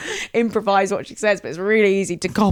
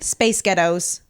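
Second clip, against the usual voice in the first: American accent; slower than usual. Shown as a demonstration. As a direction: say "Space ghettos" with American flapped t. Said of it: "It's an American".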